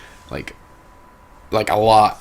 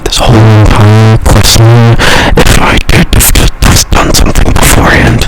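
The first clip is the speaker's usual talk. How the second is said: slow, much louder furious yell; exasperated shout; 13 dB louder than usual. Real, not acted. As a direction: yell it out in total disbelief. Rant peaks into shout.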